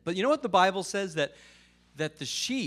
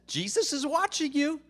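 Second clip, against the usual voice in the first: high-pitched talking parrot; 11 semitones higher than usual. Acted. Parrot warning the burglar who found it, high-pitched. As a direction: pitch it way up as the bird. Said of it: "And it says".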